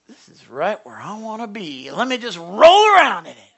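a pig delighted to wallow; content pig. Acted. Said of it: "The pig says".

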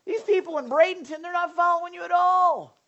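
high, loud mock plea to God; crying out. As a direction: cry out in hammed-up frustration. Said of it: "you're crying out like".

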